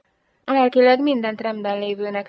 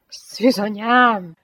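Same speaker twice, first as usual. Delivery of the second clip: very slow groaned cry, higher; groaning; 4 semitones higher, 5 dB louder, much slower than usual. A narrator's reading. Lieutenant covering his eyes as his shirt is torn, slow groan. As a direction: drag it out as a helpless groan. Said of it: "nyögte".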